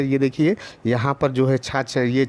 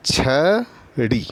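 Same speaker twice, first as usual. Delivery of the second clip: teacher stretching छड़ी for pupils to copy; धीमा, साफ़ उच्चारण; slow demonstration; much slower than usual. Shown as a demonstration. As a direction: say छड़ी very slowly and clearly. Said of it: "हम बोल के देखेंगे".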